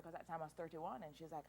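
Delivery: ordinary delivery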